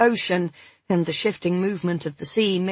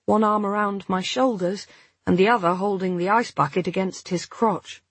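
usual speaking voice

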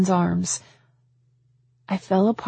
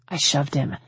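plain reading voice

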